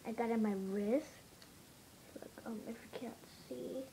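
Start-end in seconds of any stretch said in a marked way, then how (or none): 2.1-3.8 soft unvoiced whisper. Real, not acted. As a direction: whisper it under your breath. Whispered aside.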